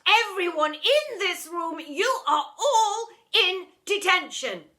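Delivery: high, slow, loud shout; deputy head snapping at everyone. A narrator's reading. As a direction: snap it at the whole room. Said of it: "Seed snapped".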